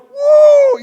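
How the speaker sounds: very high, very loud whoop of joy. Retold or imitated. Word-lover feeling loved, whooping loudly. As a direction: let out a big cheer. Acting out hearing praise.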